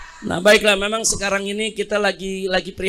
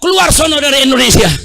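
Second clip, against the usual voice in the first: louder, shouted mock order; hammed-up official barking at protesters; mock angry official; 4 semitones higher, 6 dB louder, faster than usual. Preacher mocks an official telling protesters to leave, louder shout. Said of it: "Terus dijawab".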